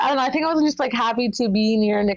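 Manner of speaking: ordinary delivery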